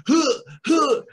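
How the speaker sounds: two loud, high mock grunts; short imitated heaves; effortful shove grunts. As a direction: grunt as if shoving someone. Imitates Lars shoving kids.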